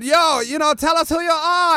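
high-pitched excited Austrian host. Retold or imitated. Bruno interviewing the wrestler, high excited voice. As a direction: ask in a high, loud Austrian voice.

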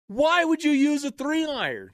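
high, loud disbelieving call; incredulous call. Retold or imitated. Friend hollering about the three iron. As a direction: shout it up in disbelief.

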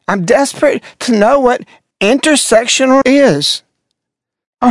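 usual speaking voice

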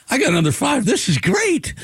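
squeaky mock glee, pitch shooting up; mock delight. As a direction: gush sarcastically over the bad news. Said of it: "And I go".

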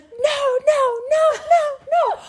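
high, loud wailing no. Retold or imitated. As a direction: cry out in high-pitched despair. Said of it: "And I'm just like".

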